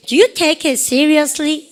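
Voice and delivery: slow question for students to repeat; slow drill. Shown as a demonstration. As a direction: model the question slowly for the class to echo. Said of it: "시작".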